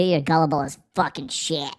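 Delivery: normal talking voice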